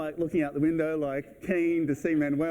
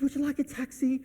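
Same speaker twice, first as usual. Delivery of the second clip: high-pitched, eager offer; happy doorman impression; 13 semitones higher than usual. Happy doorman offering guests a cab, high cheery mimicry. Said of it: "He was so happy".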